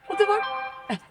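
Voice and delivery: high, incredulous what the fuck; stunned disbelief. Retold or imitated. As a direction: squeak it out, baffled. Repeated shock at dad.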